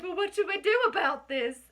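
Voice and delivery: put-on a schoolkid's rousing British accent; terrible British accent. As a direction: call out to the class in a mock British accent. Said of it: "with my terrible British accent".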